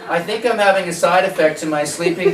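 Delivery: high, loud, laughing patient voice; hammed-up patient complaining to doctor; mock worried patient.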